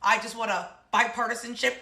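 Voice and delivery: mock Obama. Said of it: "Obama was like".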